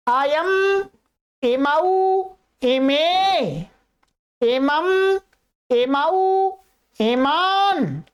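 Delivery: slow rhythmic Sanskrit recitation, one form per breath; drilled recitation. Shown as a demonstration. Teacher leading students to repeat together, slow drilled chant. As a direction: lead the class through the forms steadily. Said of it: "चलिएगा, बोलिएगा".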